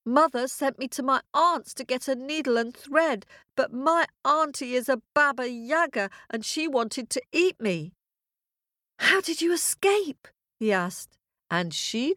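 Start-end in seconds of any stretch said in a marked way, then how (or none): 0.0-7.9 put-on girl's voice. A narrator's reading. Masha telling her dad. 9.0-10.3 anxious question. A narrator's reading. Dad asks.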